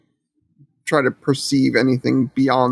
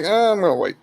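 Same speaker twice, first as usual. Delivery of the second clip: reluctant refusal; 6 semitones higher, faster than usual. Acted. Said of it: "shake my head at this door and be like".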